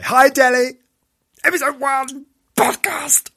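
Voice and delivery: loud, high-pitched hollered shout-out; excited shout. Acted. Host greets his niece mid-podcast, excited shout. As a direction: burst out hollering like an overexcited announcer.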